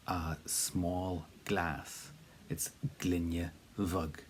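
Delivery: slowly, each word laid out; slow model phrase. Shown as a demonstration. Teacher modelling the phrase slowly for learners. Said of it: "Just say it, glynne vug".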